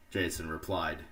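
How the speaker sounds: ordinary delivery, nothing marked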